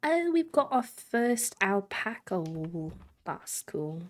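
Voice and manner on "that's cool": ordinary delivery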